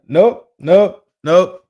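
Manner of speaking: loud, high, rising nopes; emphatic refusal. Retold or imitated. Retelling how he turned them away, emphatic refusal. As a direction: imitate a firm, punched refusal. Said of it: "He said".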